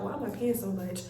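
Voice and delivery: low, soft muttered wondering; questioning herself. Retold or imitated. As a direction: mutter it privately to yourself. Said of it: "I just was questioning myself".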